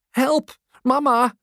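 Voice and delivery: mock scream, very high-pitched; startled cry. A narrator's reading. Boy swept along by crowd calls mum, startled. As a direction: cry for help in a high, alarmed child's voice. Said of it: "schreeuwt geschrokken".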